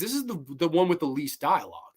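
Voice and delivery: ordinary delivery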